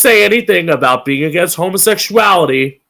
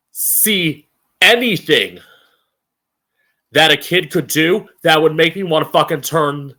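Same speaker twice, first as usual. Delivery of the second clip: ordinary delivery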